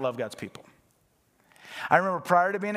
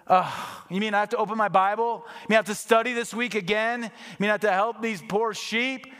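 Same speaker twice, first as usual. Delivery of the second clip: whiny, put-upon questions, pitched up; hammed-up grudging complaint; mock reluctant preacher; 5 semitones higher, 3 dB louder than usual. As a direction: grumble like a lazy preacher. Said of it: "Or if I preach like that".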